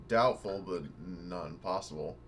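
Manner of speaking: usual speaking voice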